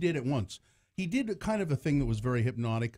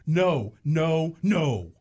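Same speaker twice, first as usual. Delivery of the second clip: louder, acted string of refusals; emphatic refusal; 3 semitones higher, 6 dB louder, much slower than usual. Father quoting his daughter refusing the stage, emphatic. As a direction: refuse each time with more weight.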